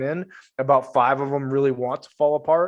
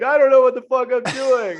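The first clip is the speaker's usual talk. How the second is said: much higher, louder, half-laughing despair; mock laugh-crying man; 11 semitones higher, 8 dB louder than usual. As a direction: voice a man laughing through tears. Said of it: "laugh crying and be like".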